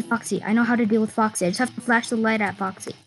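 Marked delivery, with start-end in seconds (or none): none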